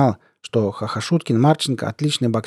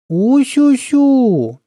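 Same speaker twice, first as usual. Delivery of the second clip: put-on very high, drawn-out baby-talk coo; mocking; 13 semitones higher, 6 dB louder, much slower than usual. A narrator's reading. Colonel sneering at a soldier's threat, mocking. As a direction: coo loudly in open mockery. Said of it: "издевательски сказал полковник".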